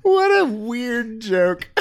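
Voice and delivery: words squeezed out through laughter; cracking up mid-sentence; laughing. Real, not acted. Laughing reaction.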